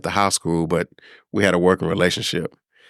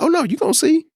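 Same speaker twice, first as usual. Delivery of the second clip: much higher, playful and sure; confident promise; faster than usual. Retold or imitated. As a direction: tease the boss with it. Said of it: "I'm like".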